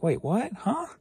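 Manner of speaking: sudden high-pitched confusion; mock surprise. Seller suddenly spotting late shipments, high confused surprise. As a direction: voice jumps up in disbelief. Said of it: "I'm like".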